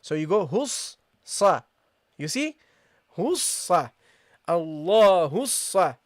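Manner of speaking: Allahus sa said slowly with a doubled sad. Shown as a demonstration. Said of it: "This is how you pronounce it".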